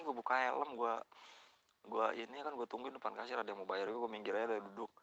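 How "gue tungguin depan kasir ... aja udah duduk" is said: ordinary delivery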